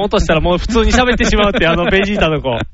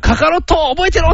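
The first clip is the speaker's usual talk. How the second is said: high-pitched anime rival's threat; ベジータ; 8 semitones higher than usual. Retold or imitated. Anime warrior vowing revenge on his rival, mock menace. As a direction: mimic a vengeful cartoon warrior, high. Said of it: "みたいな感じでベジータが".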